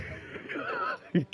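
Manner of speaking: breathy high giggle, caught out; embarrassed giggle. Real, not acted. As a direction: giggle at your own slip. Laughing at mispronouncing driver name.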